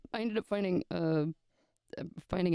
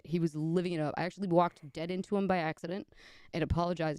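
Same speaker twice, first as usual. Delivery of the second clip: normal talking voice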